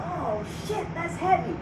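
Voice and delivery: high, hushed amazed oh shit; a touch of stoned awe; stunned realization. Retold or imitated. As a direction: marvel at it, high and awed. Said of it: "I'm like".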